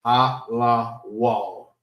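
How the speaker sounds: "A, la, wall" as three separated sounds; sound-by-sound demo. Shown as a demonstration. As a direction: step through "A, la, wall", falling on the last. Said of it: "how to say words".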